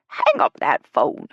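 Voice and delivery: loud, slow, emphatic command; scolding order. Acted. Ella ordering Jill off the phone, loud and slow. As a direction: hit each word hard.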